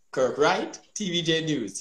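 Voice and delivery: normal talking voice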